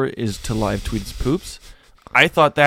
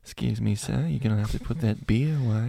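soft, hushed Australian librarian; subdued Australian accent; 3 semitones higher, 9 dB quieter than usual. Acted. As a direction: shush a patron in a quiet Aussie accent. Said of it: "a more subdued Australian".

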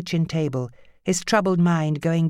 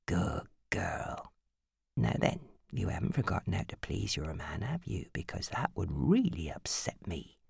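soft, low, creepy coaxing; soft menace; 9 semitones lower, 11 dB quieter than usual. A narrator's reading. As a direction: coax her darkly.

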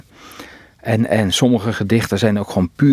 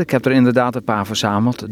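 ordinary talk, nothing marked

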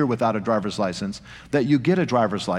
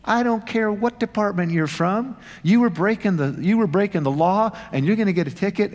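higher, hammed-up stern officer voice; mock angry cop; 8 semitones higher than usual. Traffic cop scolding the pulled-over driver, mock angry. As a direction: play the unfriendly cop scolding them. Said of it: "it was not friendly at all".

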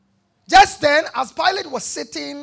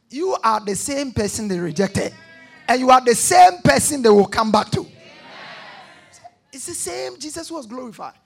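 from steady to a louder ringing shout; building preaching; 3 semitones higher, 7 dB louder than usual. Acted. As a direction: swell it toward the crowd. Preaching at full force.

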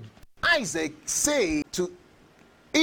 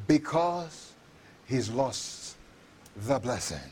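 hushed; 10 semitones lower, 8 dB quieter than usual. Real, not acted. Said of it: "sermon emphasis".

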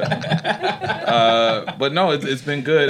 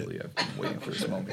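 sensual; 4 semitones lower, 13 dB quieter, faster than usual. Acted. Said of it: "that was quite sensual".

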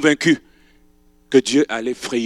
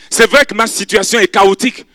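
raised, earnest plea; pleading young widow; 9 semitones higher, 9 dB louder than usual. Acted. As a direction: beg to stay with her. Ruth speaks of her misery.

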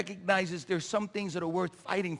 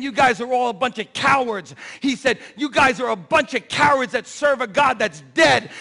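mock giant taunt; 5 semitones higher, 10 dB louder than usual.

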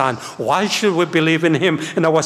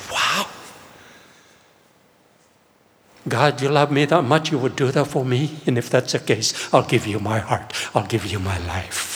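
softer and lower, fading almost to nothing; awed surrender; 4 semitones lower, 4 dB quieter than usual. Retold or imitated. Young [name] answering God, hushed and fading. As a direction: give your heart away in hushed awe. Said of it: "And I said".

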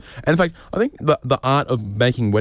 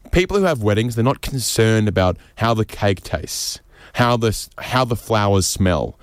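normal talking voice

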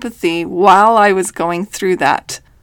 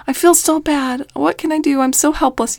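mimicking distressed sister; 7 semitones higher than usual. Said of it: "she just felt so bad".

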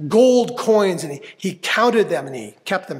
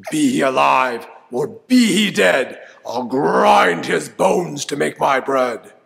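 booming giant; 7 dB louder than usual. Acted. Giant bellowing to smell out Jack, loud booming voice. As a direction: thunder it out like a hungry giant.

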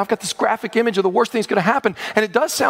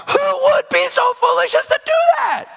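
very high, exasperated cry; incredulous shout. Real, not acted. Preacher challenging the congregation's trust in lies, incredulous shout. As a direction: cry out in disbelief at the folly.

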